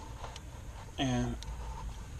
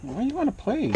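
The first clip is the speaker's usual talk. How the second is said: much higher sing-song voice for the cat; 7 semitones higher, 4 dB quieter than usual. Real, not acted. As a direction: coo at the cat in a high voice. Baby talk to the cat.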